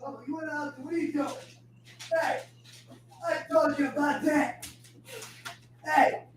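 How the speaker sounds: talking to dog. Real, not acted. To his dog, a high soft scolding.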